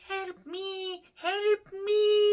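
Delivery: very high, squeaky little plea; drawn-out falsetto "help me"; tiny-fly movie quote. Acted. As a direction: imitate a tiny trapped creature crying for help. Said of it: "version of the nineteen fifties classic".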